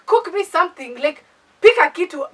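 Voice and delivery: loud, high, exasperated order; retold angry demand. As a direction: re-enact snapping at a useless host. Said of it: "at this point I was mad".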